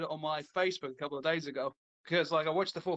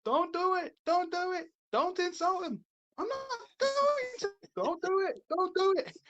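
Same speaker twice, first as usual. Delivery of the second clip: high-pitched mock pleading; imitating worried crowd; 12 semitones higher than usual. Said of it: "you hear the rest of the crowd".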